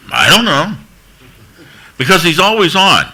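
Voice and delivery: high-pitched helpless answer; comic bafflement. Real, not acted. Speaker asked what the star is like, baffled. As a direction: pitch it up for a punchline.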